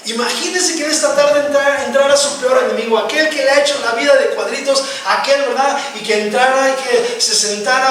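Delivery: preacher pushing the congregation, loud and high; fiery preaching. Acted. Preacher to congregation about enemies: loud, high pleading preaching. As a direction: preach it full force, building.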